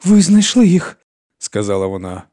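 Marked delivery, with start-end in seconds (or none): 0.0-1.0 високий зворушений вигук; вигукнути високо й голосно, усміхаючись крізь сльози; радість крізь сльози. A narrator's reading. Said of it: "усміхаючись до Ірен клі-, крізь сльози". (зворушено, високим голосом)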